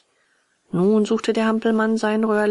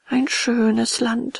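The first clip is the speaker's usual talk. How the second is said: slow, drawn-out, longing sigh in higher voice; wistful sigh; 5 semitones higher, 4 dB louder, much slower than usual. A narrator's reading. Puppet tempted by lazy land, slow wistful sighing. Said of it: "seufzte Bengele".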